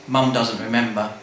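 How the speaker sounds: plain reading voice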